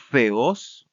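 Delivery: "Theos" as the-OS, OS loud and drawn out; stress on last syllable. Shown as a demonstration. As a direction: land hard on the final syllable of "Theos". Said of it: "acento agudo en la última sílaba".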